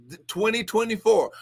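ordinary delivery

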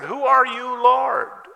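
very high, loud bewildered question; imitated alarmed shout; startled cry. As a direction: cry it out to the heavens, stretching the last word. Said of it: "So Saul said".

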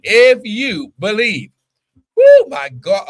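high, loud climax with a whoop; fervent preaching. Acted. Preacher whipping up the congregation, fervent and loud. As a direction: cry it out, lifting into a shout.

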